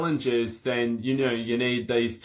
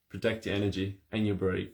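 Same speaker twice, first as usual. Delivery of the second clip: ordinary talk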